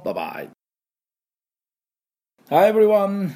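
normal talking voice

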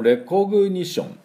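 レコグニション in Japanese katakana sounds, every vowel sounded; Japanese pronunciation. Shown as a demonstration. Teacher showing learners the katakana reading, レコグニション in Japanese pronunciation. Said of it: "日本語発音で言うと".

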